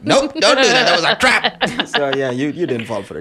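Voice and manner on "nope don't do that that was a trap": mock warning. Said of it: "I was gonna be like".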